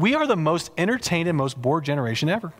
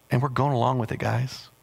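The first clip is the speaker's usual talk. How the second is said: ordinary talk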